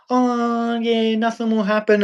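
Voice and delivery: high, airy mock girly voice; mocking girl. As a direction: parody of a carefree girl. Mocking naive girls.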